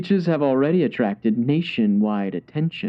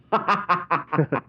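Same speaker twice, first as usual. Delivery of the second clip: villain chuckle; 4 semitones higher than usual. Acted. Villain gloats over plan.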